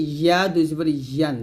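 ইয়া with a firm y from mid-tongue to palate; yā sound. Shown as a demonstration. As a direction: glide clearly into ইয়া and ইয়ান. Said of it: "জিহ্বার মধ্যখান". (ইয়া জিহ্বার মাঝখান তালুতে লাগিয়ে)

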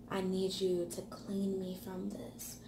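hushed plea, breathed not voiced; whispered prayer. Believer whispering a prayer to God, hushed. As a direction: whisper it like a private confession.

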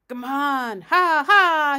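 high, very loud mock laughing cry; loud character cackle. A narrator's reading. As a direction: burst out with a big hammed-up ha-ha. Doctor's bird-like cry in story.

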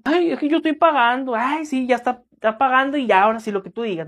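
high mocking client voice; mock entitled client. Musician mocking a paying client, high and sneering. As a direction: sneer it like a client who thinks paying buys everything.

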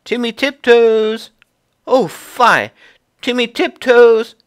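very high, loud call down a hole; loud high scolding call. Acted. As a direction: call him out sharply. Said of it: "called down".

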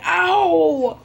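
mock cry of pain; pretend pain cry. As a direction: yelp "ow" as if hurt. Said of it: "I will, I will say".

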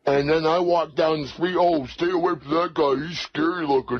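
ordinary talk, nothing marked

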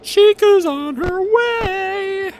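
hammed-up high warning; high sing-song. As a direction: draw it out high like a cartoon alarm. Warns of approaching enemy.

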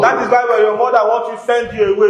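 usual speaking voice

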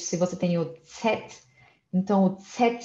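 ordinary talk, nothing marked

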